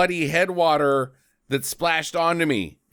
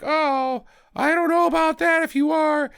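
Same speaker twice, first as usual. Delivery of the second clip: put-on higher, fretful old mom voice; mock doubtful mom; 12 semitones higher than usual. His mother answering his call about the picture, fretful higher voice. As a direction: play the doubting mother, pitched up. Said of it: "She's like".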